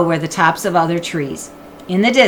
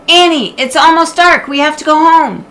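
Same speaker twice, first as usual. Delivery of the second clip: calling up; 10 semitones higher, 7 dB louder than usual. Acted. Jack calling up.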